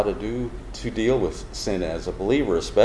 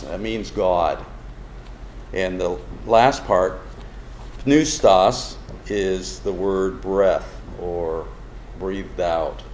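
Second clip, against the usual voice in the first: usual speaking voice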